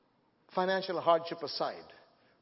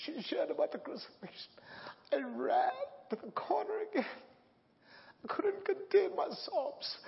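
tearful; 11 semitones higher than usual. Real, not acted. Preacher remembering crying in the dark corner, tearful. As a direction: voice cracking high with crying. Recalling sobbing as a boy.

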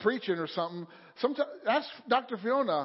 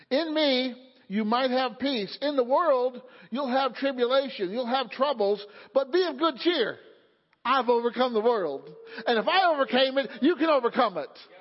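raised, insistent sermon delivery; emphatic preaching; 4 semitones higher, 4 dB louder than usual. Real, not acted. Preacher assures congregation they can overcome, emphatic and building. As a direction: preach it out, building higher and louder. Preaches scripture with rising force.

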